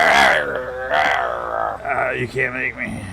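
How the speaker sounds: put-on slurred drunk being kicked out; drunk guy voice. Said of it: "the drunk guy that's being told to leave the bar".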